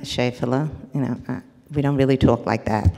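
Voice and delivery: put-on a mother calmly correcting her child; gentle correction. Shown as a demonstration. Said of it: "So we'll say something like".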